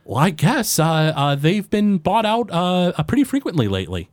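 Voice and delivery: a small forest gnome greeting a customer; high-pitched gnome shopkeeper; gnome baker. Acted.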